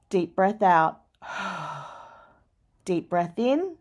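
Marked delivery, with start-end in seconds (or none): 0.1-1.8 1 long sigh out. Shown as a demonstration. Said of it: "Breathe deeply".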